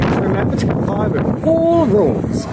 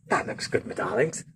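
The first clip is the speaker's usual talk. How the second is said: whisper; 9 semitones lower, 12 dB quieter than usual. Real, not acted. Choosing a film in the cabin.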